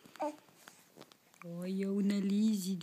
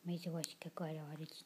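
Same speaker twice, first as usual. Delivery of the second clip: whispering; 5 semitones lower, 10 dB quieter, much faster than usual. Real, not acted. Bedtime whisper to baby.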